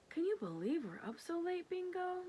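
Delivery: put-on little girl voice. Girl talks to dog.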